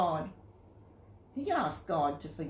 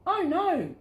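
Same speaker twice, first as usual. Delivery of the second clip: high, louder "oh no" of alarm; dismayed cry; 10 semitones higher, 5 dB louder than usual. A narrator's reading. Jonah cries out in dismay on the tossing boat. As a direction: gasp it out as the storm rages. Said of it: "said Jonah".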